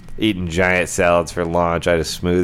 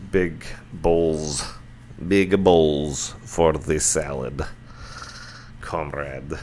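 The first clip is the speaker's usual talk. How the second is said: slow, hammed-up Russian accent; jokey fake Russian accent, drawn out; bad Russian accent; 6 dB quieter, much slower than usual. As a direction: ham up a cheesy Russian accent on the word comrade. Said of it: "Sorry for the shitty Russian accent".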